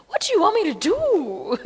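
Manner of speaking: high, whining appeal in mock despair; mock exasperated plea. Conflicted devotee complaining to Krishna, mock exasperated. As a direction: throw it up high, comically exasperated.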